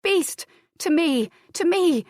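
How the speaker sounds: high, loud call for the dog; shouting. A narrator's reading.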